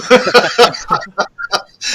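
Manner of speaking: loud high rising laugh. Real, not acted. Guest laughing at his publisher story, loud laugh. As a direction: crack up at the punchline.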